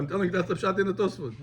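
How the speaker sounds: normal talking voice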